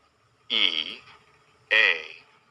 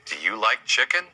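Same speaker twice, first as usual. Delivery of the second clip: normal talking voice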